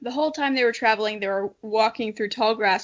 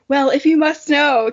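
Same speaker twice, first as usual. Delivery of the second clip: louder, annoyed retort; 3 semitones higher, 7 dB louder than usual. Acted. Kiva answers Ice Claw, louder and annoyed. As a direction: snap it back, a touch put out. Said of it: "Kiva meowed annoyedly".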